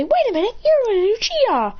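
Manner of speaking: high, excited voice of acted surprise; astonished onlooker spotting who it is; startled recognition. Strangers recognize the hiding character.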